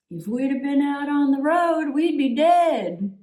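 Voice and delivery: high, grumpy old Southern man imitation; mock-cranky Southern holler; thick Southern accent. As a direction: mimic his Southern outburst, dragging the last words. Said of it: "in his thick Southern accent".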